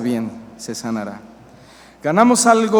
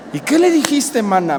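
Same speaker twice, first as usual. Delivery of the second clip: eager gossip friend; 5 semitones higher, 4 dB louder than usual. Pretend. Second gossip replies eagerly.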